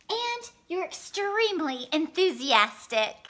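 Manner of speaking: ordinary delivery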